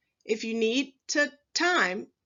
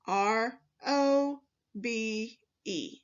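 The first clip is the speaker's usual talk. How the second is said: letters spelled out slowly, one by one; teacher spelling robe slowly for the workbook; slower than usual. Shown as a demonstration. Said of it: "just like that".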